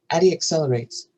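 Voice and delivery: ordinary delivery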